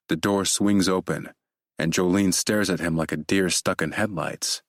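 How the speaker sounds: ordinary delivery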